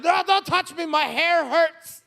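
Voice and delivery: high, loud whine, last words dragged slow; whiny shriek. Retold or imitated. Comic seasick wife pushing husband away, loud whine. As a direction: snap it like a sick spoiled child. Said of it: "she's like".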